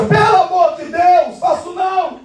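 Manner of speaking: hammed-up alarmed refusal; panicked protest. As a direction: cry out like a man caught out. Acts out the man's panicked protest.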